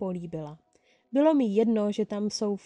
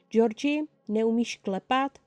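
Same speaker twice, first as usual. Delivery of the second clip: ordinary delivery, nothing marked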